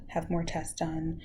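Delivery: ordinary talk, nothing marked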